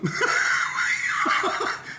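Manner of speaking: high bursting laugh; loud laugh. Real, not acted. Laughing at the insult, loud. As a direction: let out a big laugh. Laughs after fuck you.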